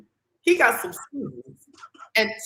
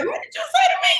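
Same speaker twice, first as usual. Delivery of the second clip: acting out outraged disbelief; mock outrage; much faster than usual. Retold indignant reply to Norwood.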